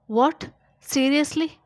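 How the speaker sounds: high, loud disbelief; surprised. Acted. Doctor hears patient is moving. (ಆಶ್ಚರ್ಯದಿಂದ ಎತ್ತರದ ಧ್ವನಿ)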